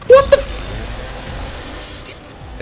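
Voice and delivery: high, stretched-out terrified squeal; scared horror game streamer. Real, not acted. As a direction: squeal in sheer panic. Monster appears suddenly.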